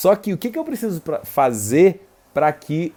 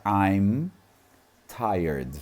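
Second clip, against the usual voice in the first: slow, each word set apart with long pauses; syllable-by-syllable English drill; 4 semitones lower, much slower than usual. Shown as a demonstration. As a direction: break the sentence into single words, slowly. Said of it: "Eu peguei palavra por palavra e eu falei cada uma delas separadamente".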